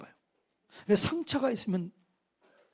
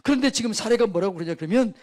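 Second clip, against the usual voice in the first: ordinary talk, nothing marked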